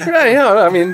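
laughing. Real, not acted. Laughing after flop joke.

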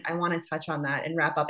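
ordinary talk

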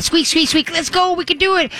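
sing-song encouragement to a reluctant dog; cheerleader voice. Shown as a demonstration. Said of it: "Become the cheerleader".

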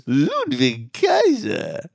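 slow, high, sneaky announcer delivery; imitating ring announcer. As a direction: draw the name out as if he's sneaky. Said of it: "the way that she says".

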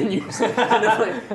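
usual speaking voice

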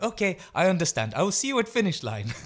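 high, loud cheerful imitation; high, loud mock-innocent farewell; Emil Zatopek. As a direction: call it out brightly in a put-on high voice. Emil takes off to win.

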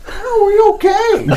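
high frightened squeak at the border; squeaky nervous question. Retold or imitated. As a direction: voice jumps way up, worried.

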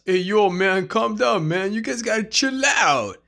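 lightly voiced greaser boss; tough greaser. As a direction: play the cocky leader. Danny calming friends.

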